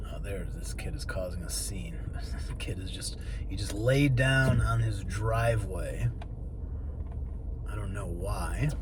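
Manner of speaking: hushed aside about a scene; whispering. Real, not acted. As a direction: whisper it so nobody hears. Commenting quietly on kid outside.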